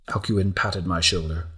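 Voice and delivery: ordinary delivery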